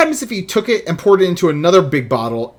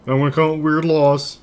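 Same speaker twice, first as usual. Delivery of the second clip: a hint of a smug politician; lightly voiced lawmaker boasting; weird politician voice. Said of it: "that's my weird politician voice".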